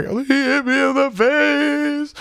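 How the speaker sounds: very high, slow mock wail; imitated crying. Girlfriend telling the boy's mom what happened, imitated wailing. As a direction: stretch it into a high, tearful cry. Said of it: "Megan just cry, cry".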